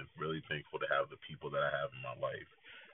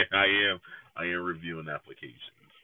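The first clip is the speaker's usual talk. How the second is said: laughing; 3 semitones higher, 8 dB louder than usual. Real, not acted. Joking about dating applications.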